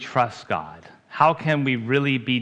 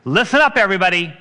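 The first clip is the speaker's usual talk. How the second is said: higher, louder call for attention; emphatic call; 6 semitones higher, 6 dB louder, much faster than usual. Shown as a demonstration. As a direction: shout it out to grab the room. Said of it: "it comes across much more emphatic".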